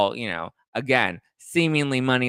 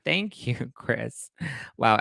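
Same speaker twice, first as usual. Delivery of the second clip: usual speaking voice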